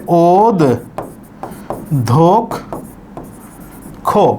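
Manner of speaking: ওদ, ধোক, খো with long pauses between; slowly sounded-out "od... dhok... kho"; syllable by syllable. Shown as a demonstration. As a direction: break it into three isolated syllables. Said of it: "উচ্চারণটা হবে".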